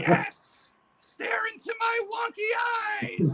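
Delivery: a high cackling boogeyman; boogeyman puppet voice. Acted. Puppet boogeyman greets the interviewer in a high creepy voice. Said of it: "Hey, boogeyman, come over here".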